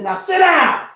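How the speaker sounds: loud, high coach command; retold trainer ordering him about; barked order.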